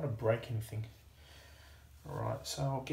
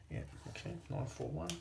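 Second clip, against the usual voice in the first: whispered mutter; 8 dB quieter than usual. Real, not acted. Finds the oil, mutters.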